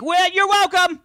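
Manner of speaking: very high put-on gadget voice; chirpy assistant. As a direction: play a squeaky, eager assistant.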